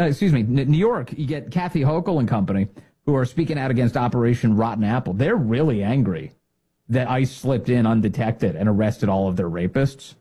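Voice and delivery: ordinary delivery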